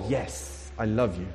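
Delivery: low, gentle, unhurried answer; calm reassurance. Acted. God replying to a guilty believer, tenderly steady. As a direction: answer the outburst with quiet certainty.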